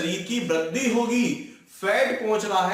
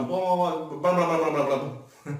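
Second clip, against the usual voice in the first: mock fast babble; 4 semitones lower, much slower than usual. Shown as a demonstration. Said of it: "मैंने जल्दी जल्दी बोला हूं".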